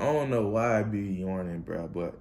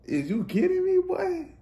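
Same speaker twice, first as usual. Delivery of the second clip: disbelief. Real, not acted. Another touchdown scored.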